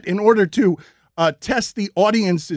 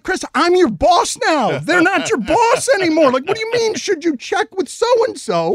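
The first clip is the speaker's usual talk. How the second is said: high-pitched, incredulous telling-off; exasperated scolding; 9 semitones higher, 3 dB louder than usual. Retold or imitated. Boss reminding nervous Chris who he works for now, exasperated.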